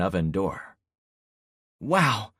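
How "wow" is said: sharp high yelp of surprise. Acted. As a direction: yelp it, startled. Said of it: "Penny yelped".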